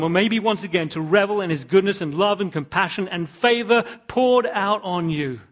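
loud, high, slowing on "holy, holy"; full-voiced sermon peak; preaching at full force. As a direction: rise to a ringing climax. Altar call climax.

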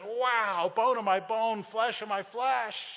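overjoyed at his bride; excited Adam. Retold or imitated. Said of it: "and he says".

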